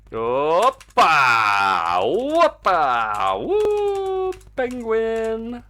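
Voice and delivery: loud whooping cheer as the penguin flies; excited cheering. Real, not acted. Streamer cheering his game penguin on, excited whoops. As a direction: whoop it up at the screen.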